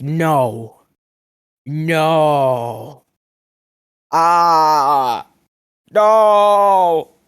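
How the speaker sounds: hammed-up whiny wail building to a shout; wailing refusal. Rival refusing to hand over his muffin recipe, hammed-up wailing. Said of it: "He's like".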